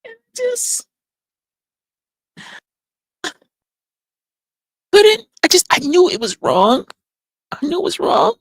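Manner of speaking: high, straining voice giving in to the urge; high desperate craving. Acted. Telling the group how the urge won, high and strained. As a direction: pitch it up like the craving took over.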